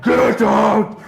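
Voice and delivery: loud angry bellow; raging shout. Retold or imitated. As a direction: roar it like a raging bear. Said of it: "raging bear that just cannot be fucked".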